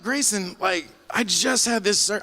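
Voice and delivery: high, pleading retold complaint; exasperated protest. Patient pleading with Grayson after surgery, exasperated.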